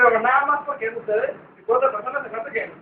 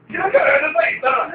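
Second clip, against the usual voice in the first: ruego llorón; 5 semitones higher, 7 dB louder than usual. Acted. Ruego llorón por la guitarra.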